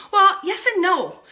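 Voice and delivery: usual speaking voice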